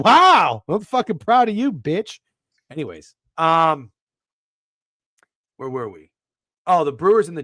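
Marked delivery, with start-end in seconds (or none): 0.0-2.2 louder, higher teasing congratulations; hammed-up pride to rib a friend; mock praise. Host ribbing co-host over email voice note, mock proud.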